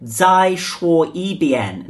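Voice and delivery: slow, separated syllables; slow syllable demo. Shown as a demonstration. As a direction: lay out each syllable slowly for learners. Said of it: "we've got this".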